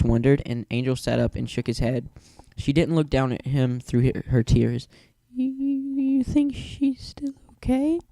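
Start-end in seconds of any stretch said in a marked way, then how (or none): 5.3-8.0 high, breathless, stammering whisper; frightened whisper. A narrator's reading. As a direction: ask in a tiny scared whisper. Fluttershy asks Angel, frightened.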